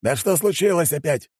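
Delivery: high-pitched exasperated outburst; exasperated Juan, pitched high; irritated cursing. A narrator's reading. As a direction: snap in a high, annoyed voice. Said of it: "выругался Хуан".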